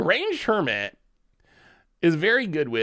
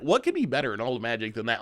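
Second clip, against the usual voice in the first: usual speaking voice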